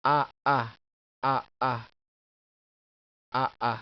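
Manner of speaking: "a, a" as two loud, distinct vowels; teacher drilling "a, a" with a clean break between; separated vowels. Shown as a demonstration. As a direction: hit each "a" separately and loud. Drilling the doubled vowel of aalis.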